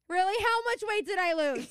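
high, loud, playful retort; exaggerated questioning cry; mock eager. Imagined comeback to the officer about weight, loud and mock-eager.

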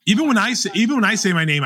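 normal talking voice

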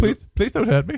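mock-frightened pleading, hammed-up; terrified horror victim. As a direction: beg as a scared victim, hammy. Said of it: "this is what happens when I get afraid".

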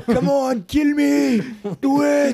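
high shouted dare, in character; action hero shout. Acted. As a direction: yell the challenge like a movie hero. Movie quote shouted in character.

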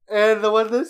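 ordinary talk

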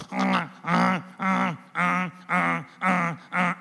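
steady repeated mother-goose honk; imitated goose honks. Mother goose drilling her voice into the gosling, repeated honks. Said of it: "su graznido".

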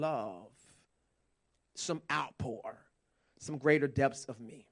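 hushed, intimate voice of God. Acted. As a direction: breathe it like a secret. Voicing God, sudden drop.

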